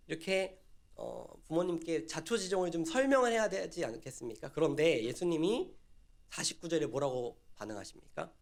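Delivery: ordinary delivery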